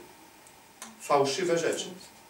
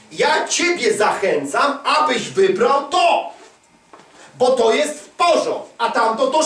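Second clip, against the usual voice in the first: shouted appeal; 6 semitones higher, 4 dB louder than usual. Acted. Preacher voicing God, pitching the good choice loudly. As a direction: sell it hard, almost a shout.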